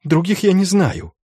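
high, pleading prisoner voice; frightened denial. A narrator's reading. Schulz tells inquisitors he knows no others, frightened high denial. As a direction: protest in a frightened high pitch.

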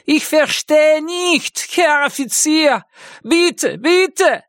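very high, wailing German plea; high-pitched frantic begging; panicked pleading. Acted. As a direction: beg for mercy, pitch way up. Terrified hanged man begging at gunpoint.

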